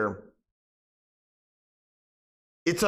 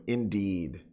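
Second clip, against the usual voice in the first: deep mock-posh indeed; 10 semitones lower, 6 dB quieter than usual.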